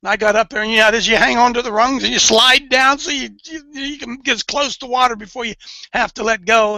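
ordinary talk

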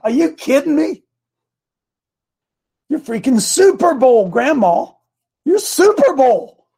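high, rising, incredulous cheerleading; emphatic pep talk. Retold or imitated. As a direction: build her up with loud, high-pitched conviction. Retold pep talk to his wife.

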